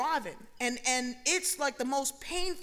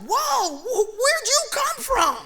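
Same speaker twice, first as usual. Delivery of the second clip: surprised whoa; 6 semitones higher, 6 dB louder, slower than usual. Retold or imitated. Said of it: "I'm like".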